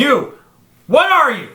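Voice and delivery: high, loud, incredulous; mock outrage; exasperated demand. Real, not acted. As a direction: yell the question at him. Repeated outraged question.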